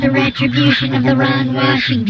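fast, frightened rush to leave; panicked hurry. Pretend. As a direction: hurry off in mock fear. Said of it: "I cannot speak anymore. I must leave".

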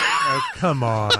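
shouted laughing complaint; laughing protest. Real, not acted. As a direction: cry out through laughter.

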